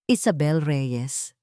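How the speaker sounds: ordinary delivery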